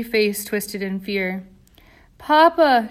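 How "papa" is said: high, loud scream for her father. A narrator's reading. Little girl in the elevator screams for her father, terrified. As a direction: shriek for Papa in terror. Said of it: "she screamed".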